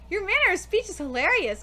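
ordinary talk

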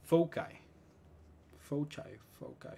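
foci como fousai. Shown as a demonstration. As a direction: di foci con ai final. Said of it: "y podemos decir". (foci en plural)

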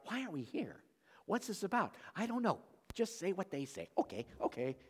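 hushed, puzzled mock crowd voices; confused crowd. Pastor playing confused Ephesian rioters, soft and puzzled. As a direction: voice clueless rioters muttering softly. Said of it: "They didn't even know why".

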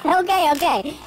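ordinary talk, nothing marked

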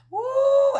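ecstatic high woo; preacher's joyful shout; high whoop. Real, not acted. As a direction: let out a loud high whoop. Ecstatic exclamation.

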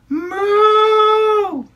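long high sung moo; drawn-out musical cow moo. Acted. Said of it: "what did the librarian hear".

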